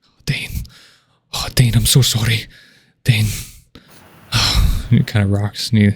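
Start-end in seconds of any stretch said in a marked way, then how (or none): 0.0-0.6 whispered grief; old dwarf muttering his son's name. Acted. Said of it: "He's just muttering Dane's name". 1.3-3.3 muttering grief. Acted. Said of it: "He's just muttering Dane's name".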